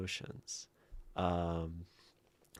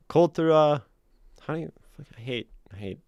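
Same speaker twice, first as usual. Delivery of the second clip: put-on French accent; 8 semitones higher, 9 dB louder, faster than usual. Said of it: "I mean, I just did".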